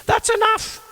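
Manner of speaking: high scolding mum; mum's voice. Retold or imitated. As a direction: tell him off sharply.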